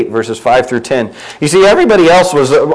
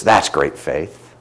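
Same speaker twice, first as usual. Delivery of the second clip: much softer, lower and slow; quiet awed praise; 10 semitones lower, 5 dB quieter, much slower than usual. Acted. Jesus marveling at the centurion, hushed and slow. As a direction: savour the words, hushed and tender.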